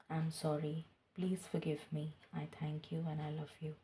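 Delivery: soft, slow spoken mantra; voiced prayer. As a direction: murmur the forgiveness prayer aloud, devoutly. Repeated forgiveness mantra, voiced.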